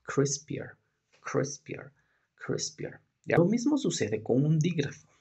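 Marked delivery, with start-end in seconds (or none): 0.0-3.0 "Crispier" repeated, fading to near whisper; model word. Shown as a demonstration. Teacher modelling the word for students, fading. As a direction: repeat "crispier" softer each time. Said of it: "cómo dividiríamos".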